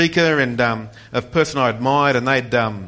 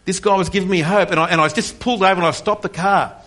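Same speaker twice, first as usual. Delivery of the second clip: usual speaking voice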